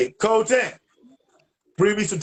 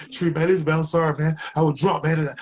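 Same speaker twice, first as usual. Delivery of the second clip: begging friend; 4 semitones higher than usual. Retold or imitated. Friend on the phone begging Tree, high and pleading.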